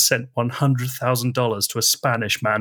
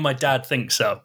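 normal talking voice

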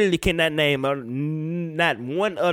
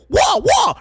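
high, loud mocking holler; mock yelp; 8 dB louder than usual. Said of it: "she just go".

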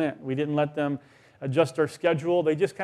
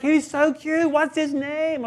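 much higher, shrill-free squealing coo; tipsy gushing lady; 11 semitones higher, slower than usual. Retold or imitated. Tipsy stranger squealing over the baby, much higher voice. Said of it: "She's like".

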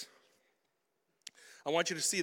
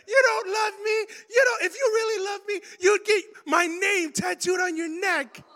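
put-on much higher, loud crying yell of a girlfriend; crying shout; 14 semitones higher, 8 dB louder than usual. Girlfriend yelling at her kneeling ex, higher tearful shout. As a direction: wail it at him like a hurt girlfriend.

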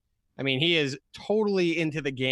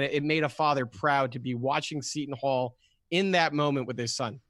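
ordinary talk

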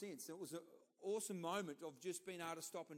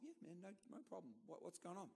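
much softer, easygoing and friendly; soft reply; 13 dB quieter than usual. Retold or imitated. Retold youth camp chat, soft and easy. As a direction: answer gently, off guard.

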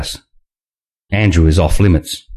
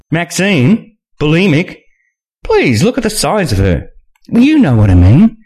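Nina voice; 8 semitones higher than usual. A narrator's reading.

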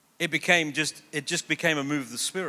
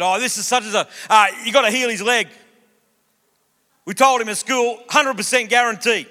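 eager mates; 4 semitones higher, 5 dB louder than usual. Retold or imitated. Mates demand healing.

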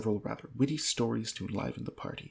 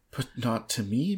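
ordinary delivery

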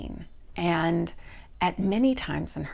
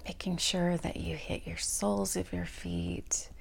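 guided meditation. Real, not acted. Host leads listeners through a body scan, guided meditation voice. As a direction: ease them through a body scan, unhurried and spacious.

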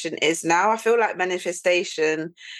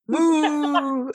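high mooing word; cow moo; 7 semitones higher than usual. Pretend. Imitating a cow.

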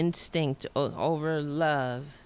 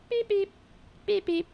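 squeaky beep. Pretend. Booping the cat.

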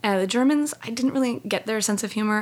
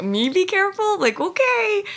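much higher, mock disbelief; incredulous; 12 semitones higher, 4 dB louder, slower than usual. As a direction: squeak it out in amused disbelief. Tiny her mocking giant's warning.

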